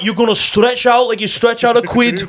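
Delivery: high mock cockney; cheeky London geezer; English accent. Friend joining the English yoga bit, mock cockney.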